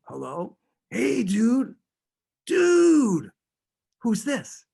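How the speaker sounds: high, loud, hammed-up Boston dude voice; terrible Boston accent. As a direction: overdo a Boston stoner accent on the phone. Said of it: "I'm talking in a terrible Boston accent".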